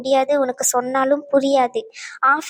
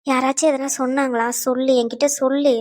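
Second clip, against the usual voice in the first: ordinary talk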